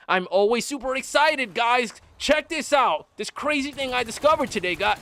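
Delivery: high, super-excited YouTuber parody; mock high pitch. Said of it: "high pitch".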